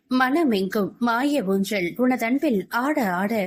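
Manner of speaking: slow, drawn-out lyrical delivery; poetic verse. A narrator's reading. Narrator offering a love verse after the chapter, lyrical. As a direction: linger on each phrase of the verse.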